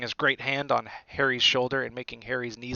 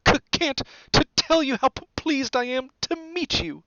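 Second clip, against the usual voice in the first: high, stuttering timid professor; nervous stammer; 13 semitones higher, 7 dB louder than usual. A narrator's reading. Professor Quirrell greeting Harry, high nervous stammer. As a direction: trip repeatedly on the first sound. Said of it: "stammered Professor Quirrell".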